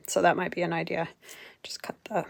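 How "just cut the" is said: whispered aside. Real, not acted. Whispered aside while working.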